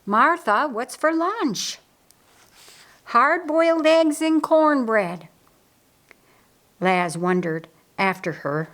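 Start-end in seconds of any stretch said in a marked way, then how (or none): 0.0-1.8 higher, drawn-out call. A narrator's reading. Mary asks her sister about lunch, calling out. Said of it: "Mary called out to her sister". 3.1-5.3 high, slow, carrying call; calling back. A narrator's reading. Martha answers from the door about lunch, calling back.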